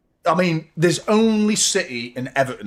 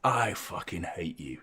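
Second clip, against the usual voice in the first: mock quiet hate; 7 semitones lower, 8 dB quieter, slower than usual. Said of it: "she says".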